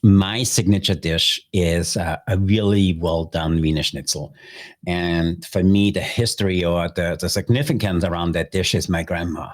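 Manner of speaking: ordinary delivery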